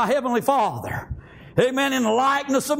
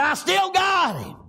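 gloating death; 7 semitones higher than usual. Acted. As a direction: drag out the taunt, hammed-up. Death gloats over Jesus' body.

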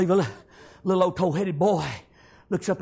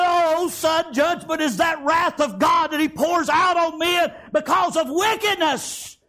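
shouted preaching; 8 semitones higher, slower than usual. Acted. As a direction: thunder it out to the boy. Grandfather preaching about God's wrath.

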